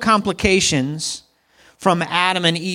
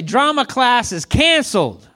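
preacher's call; 5 semitones higher than usual. Acted. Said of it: "Touch your neighbor, say".

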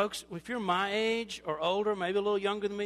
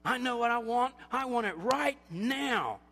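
loud, higher-pitched insistent demand; mock impatience; 4 semitones higher, 5 dB louder than usual. Self-centred older person demanding, mock impatient. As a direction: demand it, stretching the last words.

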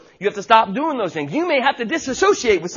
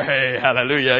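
lower, mock-pious hallelujah; churchy greeting; 9 semitones lower than usual. Pastor mocks the Sunday-morning crowd with a lower churchy hallelujah. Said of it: "when all the Christians are together".

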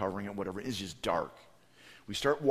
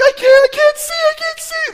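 high, loud panicked wail; screaming kids; 15 dB louder than usual. Retold or imitated. Kids freak out in the dark, panicked screaming. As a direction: shriek it like a terrified child. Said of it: "They're screaming".

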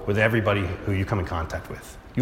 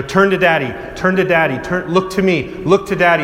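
put-on coaxing a child; 5 semitones higher, 4 dB louder than usual. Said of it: "and I go".

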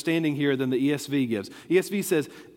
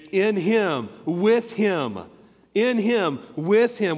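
"In him, with him" said louder, each a falling punch; stress on IN HIM, WITH HIM; 3 semitones higher, 3 dB louder, slower than usual. Shown as a demonstration. Pastor quizzing the church on his emphasis, stressed. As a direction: hammer "In him" and "with him". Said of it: "Where did I place the emphasis?".

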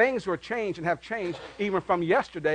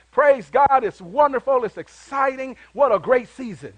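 joyful praise; 6 semitones higher, 6 dB louder than usual. Retold or imitated. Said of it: "you are just like".

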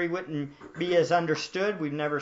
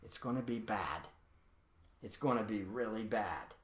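much softer, low ominous murmur; hushed dread; 8 semitones lower, 14 dB quieter than usual. Retold or imitated. The reader reacting to the pictures, hushed and ominous. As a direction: let the words sink into a hush. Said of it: "for the reader to go".

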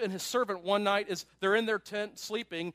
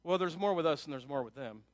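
mildly flippant, lower-pitched reply; 5 semitones lower than usual. Acted. Prophet answers his panicking servant, flippantly. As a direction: toss it off, a touch flippant. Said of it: "flippantly says".

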